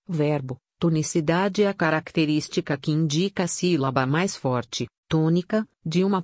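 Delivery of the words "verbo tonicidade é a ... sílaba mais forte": ordinary delivery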